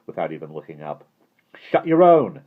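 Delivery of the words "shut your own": loud, high, combative retort; belligerent. A narrator's reading. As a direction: fire it straight back, spoiling for a fight. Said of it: "said Simon belligerently".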